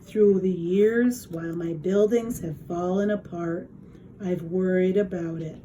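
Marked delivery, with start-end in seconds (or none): none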